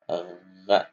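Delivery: ordinary delivery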